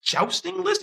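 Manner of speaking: shoots way up in disbelief; high squeaky jousting list; incredulous. Retold or imitated. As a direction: echo it back, stunned. Incredulous echo of host's words.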